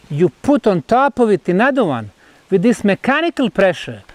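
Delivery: normal talking voice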